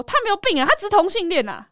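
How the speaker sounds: loud, high-pitched rebuttal; retold indignant protest; defensive mother. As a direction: re-enact a mother sticking up for her child. Mother defending child in retold quarrel.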